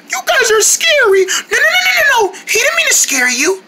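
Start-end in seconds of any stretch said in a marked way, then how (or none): 0.1-2.3 high panicked no no no; frightened crying. Pretend. Scared creature pleading with the kids, near tears. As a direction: back away in fear.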